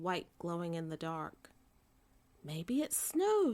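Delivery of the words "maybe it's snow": high, slow and wondering; a bear hoping for snow, excited. A narrator's reading. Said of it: "he said excitedly".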